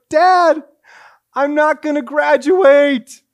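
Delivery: loud, high, drawn-out wail to Dad; panicked cry. Retold or imitated. College son phoning dad about tuition, loud panicked cry. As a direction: call out in acted desperation, high and slow.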